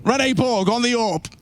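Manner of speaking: high, hyped caster call; excited play-by-play. Real, not acted. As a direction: call the round at full hype. Hyped live round commentary.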